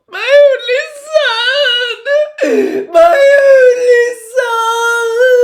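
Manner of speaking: high, loud, slow crying shout; sobbing wail. A narrator's reading. Narcissa collapses at Snape's feet, wailing. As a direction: wail it from the floor. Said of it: "sobbing and moaning on the floor".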